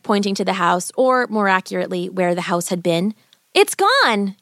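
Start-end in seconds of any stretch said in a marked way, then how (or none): 3.5-4.4 high surprised cry. A narrator's reading. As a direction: call out in amazement. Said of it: "she cried out".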